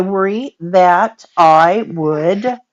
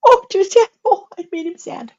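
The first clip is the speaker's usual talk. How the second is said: high-pitched cooing; 11 semitones higher than usual. Real, not acted. Grandma cooing over the baby she upset, high-pitched sympathy. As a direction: coo in a much higher voice.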